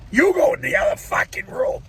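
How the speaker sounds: loud, high angry command; angry shout. Real, not acted. Man shouting at the nagging voice to leave. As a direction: bark him out of the room.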